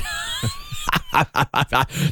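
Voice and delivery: squeaky high mock giggle; tiny shrill character cackle; weird little laugh. Bard's weird little laugh after a threat, high cackle. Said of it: "Weird little laugh".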